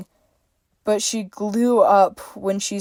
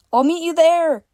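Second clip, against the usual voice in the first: put-on bright girlish call, higher and louder; higher, laughing Freya; 9 semitones higher, 4 dB louder than usual. A narrator's reading. Freya running ahead, laughing as she calls back. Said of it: "she laughed".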